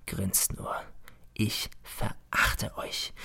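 slow, scornful whisper; a slow whispered sneer of contempt; contemptuous whisper. Acted. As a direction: hiss your contempt softly at them. Teacher faces grinning class.

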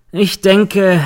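a lightly voiced sarcastic jab. A narrator's reading. Defence lawyer needles the girl, mild sarcasm. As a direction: throw it out with a sneer. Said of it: "meint der Verteidiger sarkastisch".

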